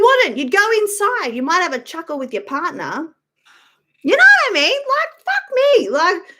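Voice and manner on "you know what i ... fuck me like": exasperated outburst. Real, not acted.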